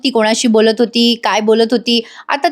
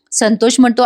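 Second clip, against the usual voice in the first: ordinary delivery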